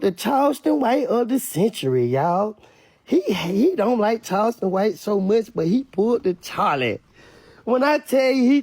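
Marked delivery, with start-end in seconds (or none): none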